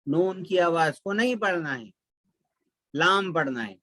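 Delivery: ordinary talk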